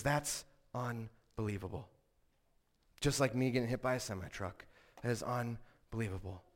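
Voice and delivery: low, soft imitated preacher verdict; Paul Washer. Preacher dismisses kids' claimed change, low quiet imitation. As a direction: lightly voice a preacher, dropping low and quiet for weight. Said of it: "the way Paul Washer responds".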